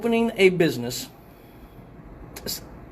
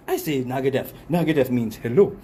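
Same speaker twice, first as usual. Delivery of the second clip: retold dialogue in African accent; 3 semitones lower than usual. Customer bantering with African braiders, African accent. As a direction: play both sides in a put-on African accent. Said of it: "so this is my African accent".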